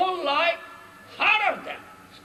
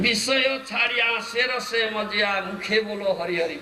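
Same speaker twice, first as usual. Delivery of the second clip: rapid verse recitation; 2 semitones lower, much faster than usual. Shown as a demonstration. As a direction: rattle off the verse very fast.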